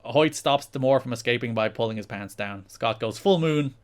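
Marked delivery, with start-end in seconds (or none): none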